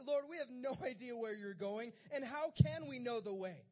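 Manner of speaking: exasperated question. Acted. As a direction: call it out, openly baffled. Thomas's blunt question acted again.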